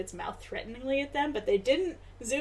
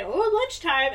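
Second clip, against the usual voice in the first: imitating a squeaky sped-up cartoon mosquito, higher and louder; high-pitched mosquito voice; 7 semitones higher, 5 dB louder, slower than usual. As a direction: do a silly high bug voice. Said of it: "like, a high-pitched, sped-up voice".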